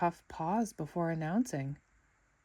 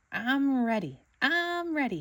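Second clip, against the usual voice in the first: shouting; 6 semitones higher than usual. A narrator's reading. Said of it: "he shouted".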